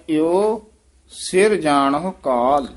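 ਇਉ set apart, then ਸਿਰ ਜਾਣਹੁ ਕਾਲ said slowly; slow, paced recitation; pause after ਇਉ. Shown as a demonstration. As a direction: break after ਇਉ. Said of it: "ਇੱਥੇ ਯਮਕ ਲਾ ਦੇਣੀ ਹੈ".

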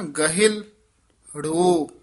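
ਗਹਿਲੜੋ said very slowly with a break between ਲ and ੜ, ਹ carrying a short i; separated syllables. Shown as a demonstration. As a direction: pull ਗਹਿਲੜੋ apart, a small gap before the ੜ. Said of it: "ਲੱਲੇ ਅਤੇ ੜਾੜੇ ਦੇ ਵਿੱਚ dash ਦੇ ਕੇ ਬੋਲਣਾ".

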